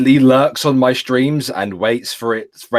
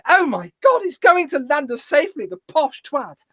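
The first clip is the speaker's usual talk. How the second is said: very high-pitched comic squeal of a passenger; hammed-up astonishment, voice way up high; mock-awed passenger. Passenger reacting to the posh pilot's announcement, mock amazement.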